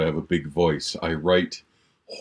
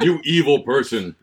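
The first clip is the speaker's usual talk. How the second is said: laughing; 7 semitones higher, 4 dB louder than usual. Real, not acted. Teasing friend over scary book.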